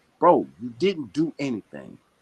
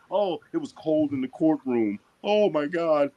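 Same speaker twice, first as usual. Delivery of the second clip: mock whining Trump; 3 semitones higher than usual. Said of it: "You always crying?".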